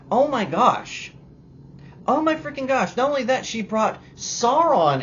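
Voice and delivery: loud high disbelief; exasperated. Real, not acted. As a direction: let it burst out in disbelief. Exasperated outburst at the show.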